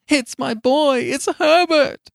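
put-on very high, loud old woman's cry; cried out. A narrator's reading. Mother struggling to reach her son, high loud cry. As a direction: cry it out desperately, high and drawn out. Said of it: "she cried".

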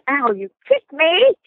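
high, slow, wounded mock yelp; mother yelping. Mother kicked under the table complains in a high slow mock yelp. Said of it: "she goes".

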